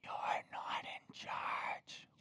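slow, hushed whisper; secretive, drawn-out whisper. Real, not acted. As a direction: whisper it slowly, like letting out a secret. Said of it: "I'm gonna whisper it".

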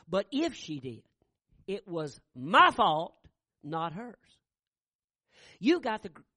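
fiery pulpit delivery; preaching at full force. Acted. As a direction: preach it to the back pews. Preacher retells, rising into fervor.

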